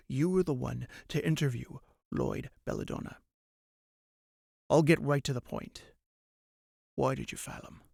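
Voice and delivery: low, soft menacing question; menacing interrogation. Acted. Mirthafan interrogating the interviewer, low quiet menace. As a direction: lean in and threaten quietly.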